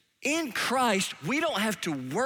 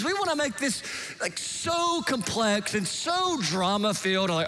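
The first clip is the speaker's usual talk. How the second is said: ordinary talk, nothing marked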